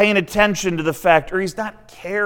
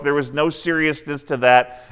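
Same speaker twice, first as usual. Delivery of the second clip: ordinary talk, nothing marked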